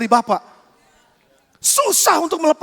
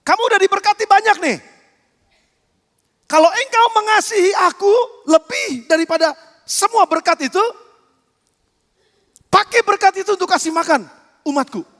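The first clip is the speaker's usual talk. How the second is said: louder and higher, building preaching; forceful preaching; 6 semitones higher, 4 dB louder than usual. Acted. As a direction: push the congregation hard. Preacher raising to full force.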